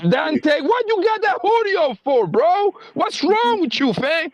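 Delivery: high-pitched mock scolding rant; escalating comedy rant. Mock rant at rival fans.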